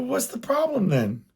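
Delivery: incredulous. Retold or imitated. Husband baffled by the complaint.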